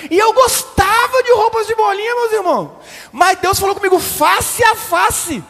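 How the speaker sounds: high, loud, sung-out mock testimony; hammed-up fervent preaching. Pastor mocking the woman's testimony, high sung fervor. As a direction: belt it like a revival sister. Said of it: "Ela falou assim".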